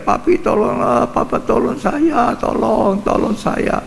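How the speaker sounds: a hint of a desperate scream; mock screaming wife. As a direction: imitate a wife crying for help, pitched high. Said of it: "Istrinya teriak-teriak".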